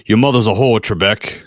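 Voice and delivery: put-on Scottish accent, lightly done, Connery style; a quick mocking Scottish jab; Sean Connery impression. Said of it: "mine comes from that guy".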